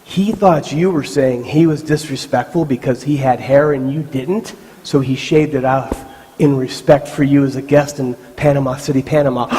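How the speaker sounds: low, hushed explanation; subdued, measured gravity. Retold or imitated. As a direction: break the bad news quietly. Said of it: "looks at me real slow".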